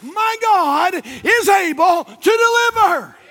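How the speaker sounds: call-and-response preaching climbing to a shout; preaching at full force. Acted. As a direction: build it up like a revival preacher.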